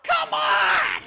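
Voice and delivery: high, loud cry of protest; frustrated scream. Real, not acted. Gamer yelling at a missed shot, frustrated scream.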